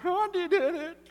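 put-on high, loud kid snitching; tattling kid. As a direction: blurt it out like a little kid telling on his brother. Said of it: "I'm like".

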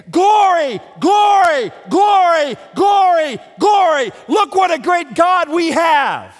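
loud, high preacher's cry of praise; exultant praise. Acted. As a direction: proclaim it in ringing shouts to the congregation. Earth praises God in sermon.

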